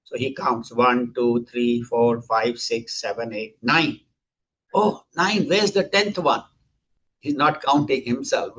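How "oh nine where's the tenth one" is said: higher-pitched, puzzled disciple; mildly acted alarm. Disciple counting his companions finds one missing, mildly alarmed. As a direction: lightly act a startled miscount.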